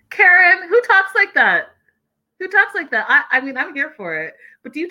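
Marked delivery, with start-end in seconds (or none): 0.1-1.7 high, loud disbelief; can't believe anyone talks like that; incredulous. Real, not acted. Vlogger reacts to Karen's reality-show line, high and incredulous. 2.4-3.0 incredulous. Real, not acted. Repeats disbelief.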